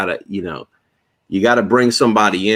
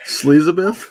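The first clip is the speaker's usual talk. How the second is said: mocking pun; 8 semitones higher, slower than usual. Joking pun on Elizabeth.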